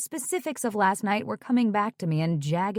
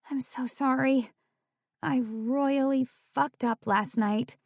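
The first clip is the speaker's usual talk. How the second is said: miserable; 5 semitones higher than usual. Acted. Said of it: "Tori sounded miserable".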